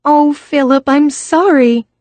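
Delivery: put-on high, sorry young woman; apologetic young woman's voice; sorry girl. A narrator's reading. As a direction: wince it out as an apology. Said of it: "at the first sign of blood".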